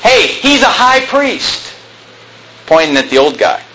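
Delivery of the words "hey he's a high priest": retold yell. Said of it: "He yells out and he says".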